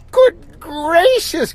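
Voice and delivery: high, slow gasp of alarm; sister's shocked high gasp; a high gasping little girl. A narrator's reading. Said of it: "gasped my sister".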